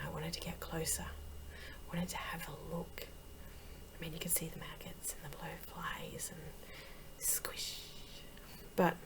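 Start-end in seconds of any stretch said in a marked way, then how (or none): 0.1-7.8 very soft, half-whispered morbid longing; hushed fascination. Acted. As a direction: drop almost to a whisper, drawn in. Drawn in close to roadkill.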